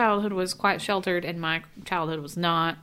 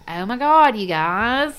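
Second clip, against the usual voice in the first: louder, higher Southern twang; Southern accent; 5 semitones higher, 8 dB louder, much slower than usual. Retold or imitated. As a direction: belt it out in an imitated Southern accent. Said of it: "I'd pick up a Southern accent".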